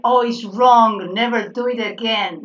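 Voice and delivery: loud, drawn-out mock telling-off; mock scolding. Teacher imitating a scolding he doesn't mean, mock stern.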